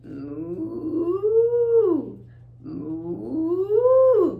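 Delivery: long moo sliding upward; cow moo. Shown as a demonstration. Teacher playing the cow sound for children, long moo. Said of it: "So let's do the moo".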